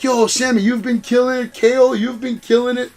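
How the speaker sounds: a hint of an overjoyed wrestler greeting his pal; hyped KO impression. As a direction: gush like an old friend hyping him up. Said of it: "He's like".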